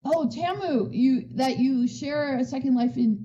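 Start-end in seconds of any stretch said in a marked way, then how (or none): none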